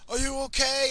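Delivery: high, loud alarmed call; frazzled. Retold or imitated. Father running to his collapsed son, high and frazzled. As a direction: shout out in worried panic. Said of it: "I'm, like, frazzled".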